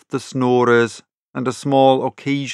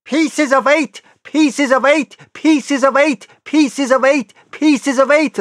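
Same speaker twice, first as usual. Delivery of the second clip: very high, shrill squawking parrot cry; Captain Flint the parrot; 13 semitones higher, 4 dB louder than usual. Acted. The parrot announcing an intruder in the dark, shrill repeated squawk. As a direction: squawk it out like a startled parrot, over and over. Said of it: "a shrill voice broke forth".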